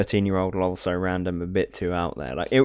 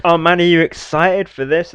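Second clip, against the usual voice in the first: higher-pitched fake excitement; mock enthusiasm; 8 semitones higher, 4 dB louder than usual. Presenters pretending to love every game, mock enthusiasm. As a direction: gush like an overeager presenter. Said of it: "had to all pretend that they loved".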